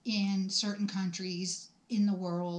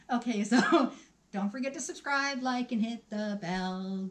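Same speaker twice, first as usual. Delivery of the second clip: normal talking voice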